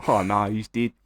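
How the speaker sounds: hammy little Australian lament; understated mock dismay in Australian; Australian accent. Imitating a lifeguard after the rescue fails, Australian accent. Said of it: "they're Australian".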